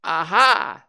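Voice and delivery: high, loud little exclamation; attempted triumph after a fright; Piglet's brave "aha". A narrator's reading. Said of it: "as well as he could after his terrifying journey".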